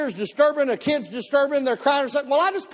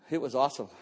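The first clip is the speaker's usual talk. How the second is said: much lower, soft and hushed; quiet; 11 semitones lower, 8 dB quieter, faster than usual. Real, not acted. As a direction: let it sink in, barely above a breath. Hushed reflective repeat.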